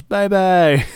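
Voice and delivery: mock crowd taunt. Crowd mocking Adam Cole as he leaves, high sing-song taunt. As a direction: tease like a jeering crowd. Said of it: "Crowd goes".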